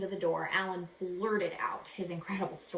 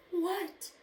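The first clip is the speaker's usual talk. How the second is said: plain reading voice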